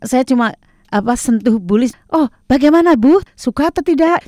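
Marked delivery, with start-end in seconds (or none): 2.1-4.3 high, bright retold greeting; lively, eager question; excited. Retelling her own friendly greeting.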